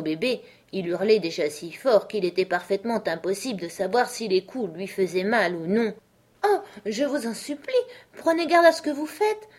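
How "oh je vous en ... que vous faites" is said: higher pleading cry of alarm; a touch of panic, rising; terrified plea. A narrator's reading. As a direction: beg them to stop, frightened. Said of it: "s'écria Alice en bondissant d'inquiétude et de terreur".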